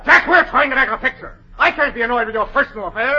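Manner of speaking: loud, high opening; harried director arguing; exasperated rebuke. Acted. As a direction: lay down the law to him. Director quarrels with the actor.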